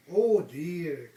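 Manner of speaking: loud little bear's cry; Baby Bear's cry. Acted. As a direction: cry out like a small bear. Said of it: "his wee little voice".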